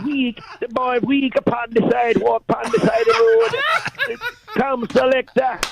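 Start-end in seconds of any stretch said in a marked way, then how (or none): 2.5-4.2 laughing high. Real, not acted. Caller laughs mocking the weak boy.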